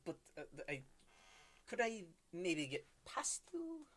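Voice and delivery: put-on quiet German-accented elf; softly asking, German accent. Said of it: "German accents kinda bleed through".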